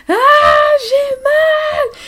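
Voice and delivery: very high anguished cry of pain; retold screaming. Being wheeled out in labour, high anguished cry. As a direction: wail it out like in labour. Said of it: "je hurlais".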